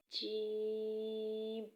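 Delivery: جیم stretched out very slowly; lengthened jeem. Shown as a demonstration. Teacher showing how long جیم is held. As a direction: draw the vowel of جیم out long. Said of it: "تھوڑا لمبا کر کے پڑھا جائے گا".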